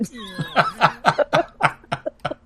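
long, high burst of laughter; helpless high-pitched laughing fit; real laughter. Real, not acted. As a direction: crack up at your own joke. Cracking up at own joke.

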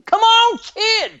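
rising to a high, loud shout; cheering the baby on, loudly; excited shout. Retold or imitated. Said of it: "and I thought".

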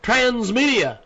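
projected announcer voice, phrase by phrase; sponsor ad read. Acted. Host plugging a new marketing partner to listeners, deliberate announcer read. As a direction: sell it with measured pauses and punched words.

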